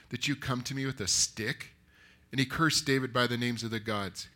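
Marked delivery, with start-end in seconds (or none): none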